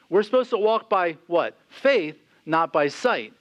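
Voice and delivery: ordinary talk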